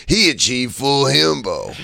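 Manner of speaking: slow dopey himbo drawl; lightly voiced dumb hunk. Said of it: "I mixed up my himbo voices".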